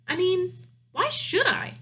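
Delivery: defiant princess voice; high, defiant girl. A narrator's reading. Princess voice in story.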